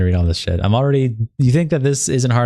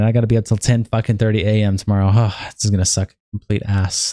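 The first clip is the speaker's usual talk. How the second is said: ordinary talk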